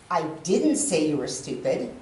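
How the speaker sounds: "didn't" stressed, the rest low. Shown as a demonstration. Speaker shows wanting to but not, stress on "didn't". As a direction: hit "didn't" as a denial. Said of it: "emphasis on each one of them individually".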